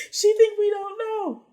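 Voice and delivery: loud, high-pitched gleeful disbelief; cracking up, crowing at an obvious liar; laughing mockery. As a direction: hoot it out between laughs. Imagined cackling mockery from back corner.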